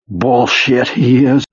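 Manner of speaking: high, indignant denial; offended disbelief; ragged and offended. Acted. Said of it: "His voice was ragged, absurdly offended".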